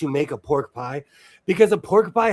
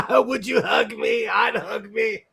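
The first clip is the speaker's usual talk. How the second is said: high, laughing mock come-on; laughing Buffalo Bill quote; 6 semitones higher than usual. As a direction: break up laughing through the line. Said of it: "[name], as Buffalo Bill".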